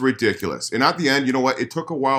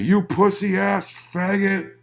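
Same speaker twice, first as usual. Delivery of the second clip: higher, slowed, mock-sneered insult; a hint of a sneering emailer; 12 semitones higher, 4 dB louder, slower than usual. Guest imitating the angry singer's email insult, mock-sneering. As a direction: drawl it out in a put-on voice, mocking him. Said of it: "the things he called me".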